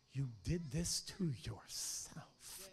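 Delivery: slow unvoiced whisper to himself. Pastor whispering to his own reflection. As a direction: breathe it at the mirror. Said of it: "look in the mirror and go".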